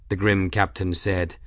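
plain reading voice

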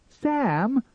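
high, loud cry of alarm. A narrator's reading. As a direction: call his name urgently, pitched high. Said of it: "cried Elma".

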